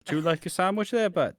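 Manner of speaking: high, quick Welsh trolley-man lilt; sing-song Welsh snack seller; Welsh accent. Retold or imitated. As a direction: offer it in a mock Welsh accent. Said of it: "he had a Welsh accent".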